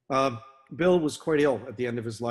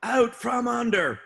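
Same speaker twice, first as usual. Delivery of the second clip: slow, high, loud drawn-out yell; yelled warning; 11 semitones higher, 6 dB louder, slower than usual. Retold or imitated. Old officer warning man under a swinging boat, yelling. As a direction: bellow the warning over engine noise, every word stretched. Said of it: "And then he yelled".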